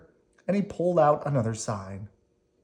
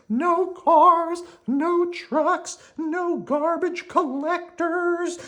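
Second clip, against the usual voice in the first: very high, slow worm voice; Slimy content; 3 dB louder, much slower than usual. Acted. Slimy relaxes with Squirmy, in a very high contented voice. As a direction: savour the peace.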